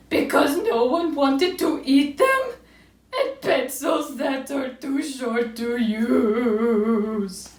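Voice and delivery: loud, slow wail, high then sinking; mock crying. A narrator's reading. Owl cries and cries, hammed-up drawn-out wail. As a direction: draw the last word into a long, wobbling howl. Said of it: "he cried".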